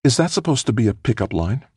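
high-pitched mock indignation; mock scowl. A narrator's reading. Woman answering a joking pickup line, mock-scowling. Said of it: "With a mock scowl, Felicia replied".

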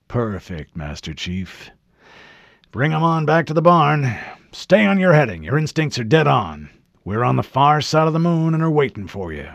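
put-on an old officer spinning it easy over the radio; drawling admiral. A narrator's reading. Voiced admiral over comm.

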